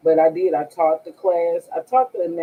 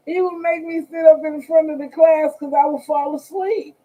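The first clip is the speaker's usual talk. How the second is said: laughing; 11 semitones higher, 5 dB louder than usual. Real, not acted. Laughing retelling, much higher.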